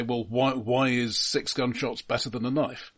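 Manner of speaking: normal talking voice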